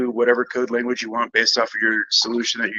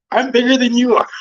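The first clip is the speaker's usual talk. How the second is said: playful indignant protest; 12 semitones higher, 6 dB louder than usual. Pretend.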